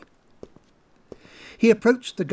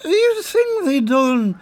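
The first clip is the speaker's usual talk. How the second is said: put-on grumbling drunk; 7 semitones higher, 3 dB louder than usual. Colm grumbling round the corner, a touch high. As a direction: sulk it to yourself.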